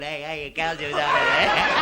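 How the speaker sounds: slow warning call; stern attendant. Retold or imitated. Camp attendant scolding someone in the chalets, slow. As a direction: drag out the telling-off. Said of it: "looking in the shellies going".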